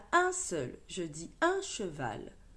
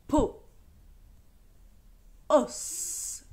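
syllabes détachées; 3 semitones lower, much slower than usual. Shown as a demonstration. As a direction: read each syllable alone, clearly, with a pause after each. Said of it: "tu répètes après moi". (syllabes lues une à une, bien détachées)

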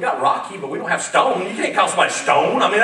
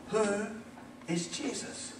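almost whispered, much softer; hushed question; 2 semitones lower, 18 dB quieter, slower than usual. Real, not acted. Pastor quietly pressing the congregation, hushed. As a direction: ask it barely above breath.

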